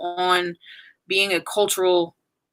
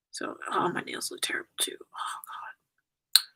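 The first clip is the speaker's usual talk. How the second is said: whispered; 10 dB quieter, slower than usual. Real, not acted. Self-conscious aside while crying.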